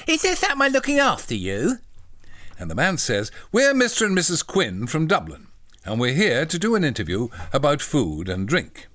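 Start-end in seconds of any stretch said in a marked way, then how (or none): 0.1-1.8 high, simple-minded little voice; eager, high-pitched polite question; childlike John. Acted. Voicing John in the story. 3.5-8.9 lightly put-on Irish accent; raised voice in a mock Irish accent. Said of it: "They're Irish".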